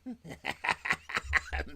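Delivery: high, loud laughing fit. Real, not acted. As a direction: let the laugh burst out high. Cracking up at the scene.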